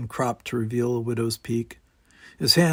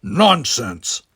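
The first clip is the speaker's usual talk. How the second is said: firm and louder Nonsense, Klingon certainty; firm dismissal; 8 dB louder than usual. A narrator's reading. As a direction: shut it down firmly. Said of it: "said Worf firmly".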